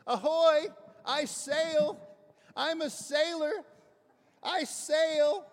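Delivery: high, loud, triumphant sailor's shout; joyful yell. Retold or imitated. Terrified man tied to mast yells his joy, loud and high. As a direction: bellow it out from the mast, thrilled. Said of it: "what he's yelling is".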